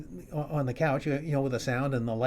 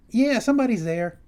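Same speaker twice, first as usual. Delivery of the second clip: high, loud reply up the stairs; calling back up; 9 semitones higher, 7 dB louder than usual. Retold or imitated. Wife calls back up the stairs, loud and high. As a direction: shout it back up to him.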